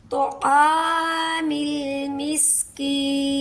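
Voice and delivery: very slow chant, Miskin held three counts; tajweed recitation; Quranic recitation. Shown as a demonstration. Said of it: "फिर हम इसे पढ़ेंगे".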